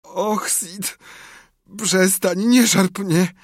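high, drawn-out groan of a patient; moaning. A narrator's reading. Tomek faking illness to his brother, moaning. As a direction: moan it out as if every touch hurts. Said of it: "jęknął wreszcie Tomek".

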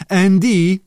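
high, loud call of a name. A narrator's reading. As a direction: summon him across the room. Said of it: "zawołał Sir Wiggum". (głośne, wysokie zawołanie)

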